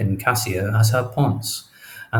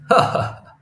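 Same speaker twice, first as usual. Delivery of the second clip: scoffing laugh. Acted. Cassio laughs at the rumour.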